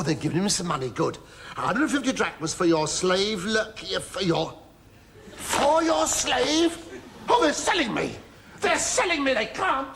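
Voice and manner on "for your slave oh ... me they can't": shocked disbelief. Acted. Slave learns he is being sold, high shocked disbelief. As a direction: gasp out the news in high disbelief.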